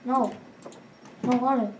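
ordinary talk, nothing marked

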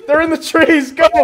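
high, loud warning to teammates; urgent shout. Real, not acted. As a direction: call out the danger urgently. Under fire in game.

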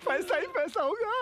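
sped-up squeaky high voice; high cartoon chipmunk pitch.

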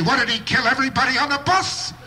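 indignant mock outrage; angry scorn. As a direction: sneer at the fat man's memory. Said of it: "they get angry".

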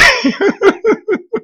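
a short, high squeal of a laugh; high-pitched laugh. Real, not acted. Amused at the viewers, short high laugh.

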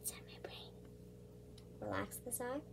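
soft whisper. Real, not acted. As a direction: whisper gently while working. Whispering during makeup.

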